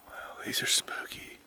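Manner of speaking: hushed whisper in the dark. Real, not acted. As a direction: whisper it, spooked. Quiet night hike in woods.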